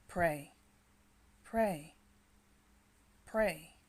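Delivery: forceful repeated command; loud urging. Shown as a demonstration. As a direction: press them to pray. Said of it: "repeated urging command".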